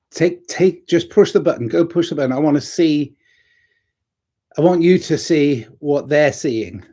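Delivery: a lightly voiced assistant, keen and insistent; pushy camera assistant. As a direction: egg him on to push the button. Camera assistant urging the narrator.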